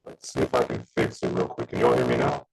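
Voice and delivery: robotic, buzzing audio; glitchy digital connection; digitized voice. Real, not acted. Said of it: "you almost sound digitized".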